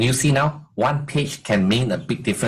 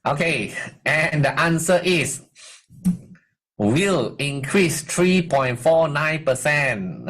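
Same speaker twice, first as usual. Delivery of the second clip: drawn out, building suspense; slow dramatic reveal; 4 semitones higher, much slower than usual. Acted. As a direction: stretch it out like a game show reveal. Suspense reveal of test result.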